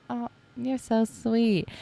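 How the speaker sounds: high, slow and touched, almost cooing; touched and delighted. Retold or imitated. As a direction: sound genuinely moved that they remembered. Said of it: "And I'm like".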